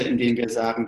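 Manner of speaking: ordinary delivery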